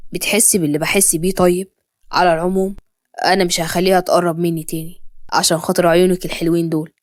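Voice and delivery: low, tender man's voice with a smile; deep-pitched, affectionate promise; loving. A narrator's reading. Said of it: "قال بابتسامة كان كلها حب".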